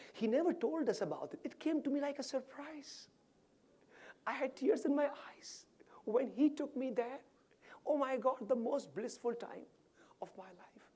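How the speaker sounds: high, thin tearful retelling; high voice falling away to a whisper at the end; imitated tearful talk. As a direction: act overcome by a happy memory. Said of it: "she's crying and talking, crying and talking".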